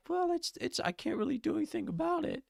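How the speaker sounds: mocking whiny quote. Cohost mocking a streamer's excuse in a high whiny voice. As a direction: whine it out like a helpless excuse-maker. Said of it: "But it's like".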